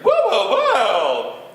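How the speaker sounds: very high, loud, fast laughing bird; a giddy little bird chirping. Acted. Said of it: "he chirped".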